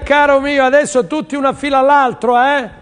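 usual speaking voice

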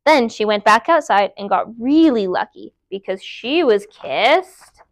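usual speaking voice

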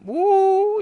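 piercing, sustained "woo"; loud playful cheer; high loud whoop. Real, not acted. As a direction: let out a big high-pitched whoop. Whooping while playing with a creeper.

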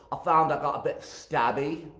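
imitating a Scottish queen; mock Scottish lilt, not quite convincing; attempted Scottish accent. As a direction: try a rough Scottish accent. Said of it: "I can't do her accent, but she said".

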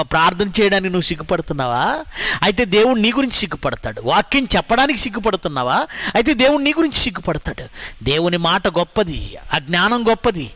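ordinary talk, nothing marked

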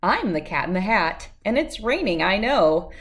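ordinary delivery, nothing marked

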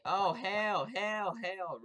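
loud, drawn-out alarmed cries; panicked yelling. Real, not acted. Gamer fleeing a bigger player, panicked loud yells. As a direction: yell as you flee.

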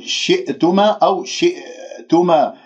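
شئتما with the glottal stop echoing into an extra vowel; unclosed hamza. Shown as a demonstration. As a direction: release the hamza as a stutter in شئتما. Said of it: "ما قفلت الأوتار الصوتية". (همزة مكررة خطأً في شئتما)

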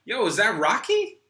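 high, loud, eager greeting; bursting with excitement at a friend; excited. Acted. C greets Rocky, high and excited.

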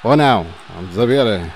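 normal talking voice

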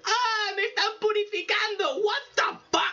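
high, shrieking mock zombie cry; screaming zombie. Reviewer acts the zombie being purified, high mock shriek. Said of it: "el zombie dice".

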